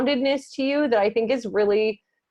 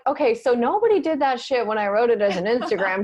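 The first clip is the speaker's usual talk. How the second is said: mock indignant self-quote. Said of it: "And I'm like".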